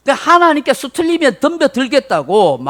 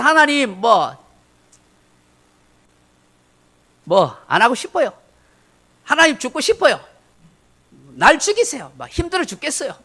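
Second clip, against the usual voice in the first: sulky complaint; 4 semitones higher than usual. Retold or imitated. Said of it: "삐딱하면 성질나서".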